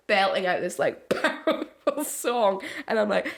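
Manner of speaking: ordinary talk, nothing marked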